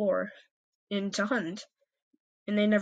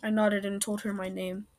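ordinary delivery, nothing marked

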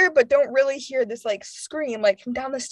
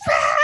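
mock shriek of fright; imitated startled scream; 3 dB louder than usual. Jumpy friend spooked by teasing friends, imitated scream. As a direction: re-enact jumping at a prank. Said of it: "realized that I was jumpy".

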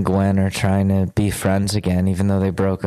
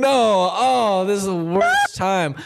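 a higher, sobbing "no, this is the worst time"; crying dismay; 10 semitones higher, slower than usual. Retold or imitated. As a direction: despair through tears as the sirens appear. Sees sirens while crying.

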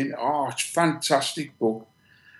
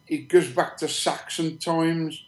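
usual speaking voice

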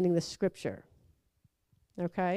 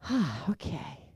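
unbothered calm; 8 semitones lower, 10 dB quieter, slower than usual. Retold or imitated. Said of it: "I'm like".

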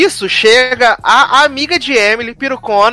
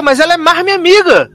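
high-pitched mock argument; hammed-up indignation; indignant retort; 10 semitones higher, 3 dB louder, faster than usual. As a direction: argue back as the offended friend. Acted quarrel between friends.

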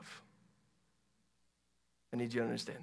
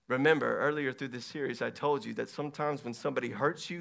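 ordinary delivery

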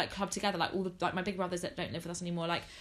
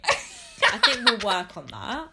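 laughing; 8 dB louder, much slower than usual. Real, not acted. Laughing after fumbled intro.

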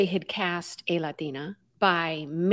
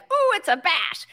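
mock excitement; 9 semitones higher than usual. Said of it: "people are gonna feel like".

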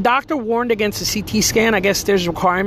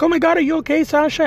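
much higher, alarmed cry of concern; worried grandma; 9 semitones higher, faster than usual. Retold or imitated. Grandmother sees granddaughter fall, alarmed and worried. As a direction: gasp it out like a frightened grandmother.

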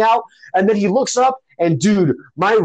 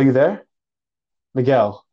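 ordinary delivery

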